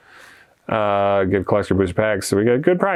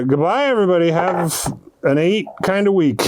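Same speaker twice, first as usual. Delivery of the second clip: cheery sign-off; 6 semitones higher than usual. Real, not acted.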